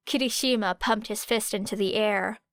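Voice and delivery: ordinary delivery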